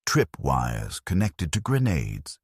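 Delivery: ordinary delivery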